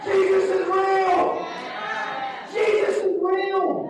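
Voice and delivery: an amazed convert repeating the discovery; awestruck repeat. Acted. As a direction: savor it like a revelation. Awestruck convert voiced in story.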